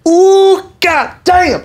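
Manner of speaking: high, loud, drawn-out exclamation; whooping, high-pitched show of amazement; loud customer imitation. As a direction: play a loud shopper whooping over new shoes. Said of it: "He puts 'em on. He's, like, loud".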